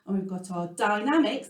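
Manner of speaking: ordinary delivery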